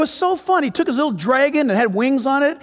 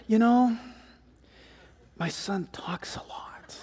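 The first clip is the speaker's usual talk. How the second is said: hushed aside, falling to a slow whisper; confiding father; 9 dB quieter, slower than usual. Retold or imitated. Father warning his seatmate about his talkative son, confiding whisper. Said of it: "the father said".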